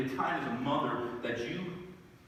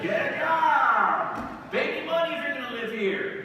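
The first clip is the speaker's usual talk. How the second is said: hammed-up fatherly yell; mock yell; 10 semitones higher, 6 dB louder than usual. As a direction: bellow it like a fed-up dad. Mock yelling at grown sons.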